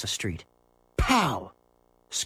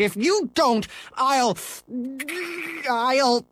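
put-on furious boy; 13 semitones higher than usual. Scooter demands a new ball, high angry rant.

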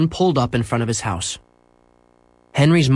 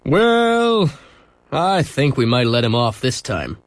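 police driver; slower than usual. Acted. Police driver deciding to let Henry off, easygoing. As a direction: let him off kindly. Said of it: "answered the driver".

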